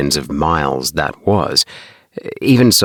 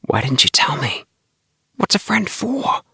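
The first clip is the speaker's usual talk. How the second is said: hushed whisper; 10 semitones higher than usual. A narrator's reading. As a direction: whisper a hurt question. Tommy still whispering to Bart.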